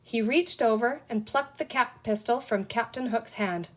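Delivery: ordinary delivery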